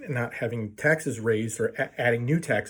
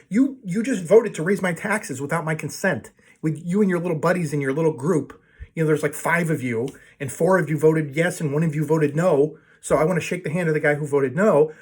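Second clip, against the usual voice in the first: heated, higher and louder, building to a yell; angry rant at the board; 5 semitones higher, 4 dB louder than usual. Retold or imitated. Neighbor yelling at board members over raised fees, angry. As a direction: tear into the volunteer board. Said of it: "And everybody's like".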